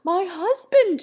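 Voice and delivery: high, loud terrified cry; wild shriek. A narrator's reading. Wife caught by her husband, wild shriek. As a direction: shriek it as if about to faint. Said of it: "she cried with a wild shriek".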